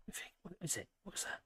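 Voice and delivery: hushed whisper to a pet; whispering to the dog. Real, not acted. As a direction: breathe the question softly to the dog. Talking softly to his dog.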